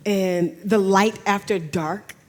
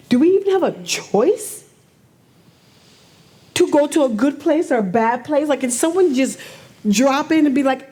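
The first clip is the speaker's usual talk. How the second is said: upset; 6 semitones higher, 3 dB louder than usual. Real, not acted. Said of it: "Clearly, I'm upset".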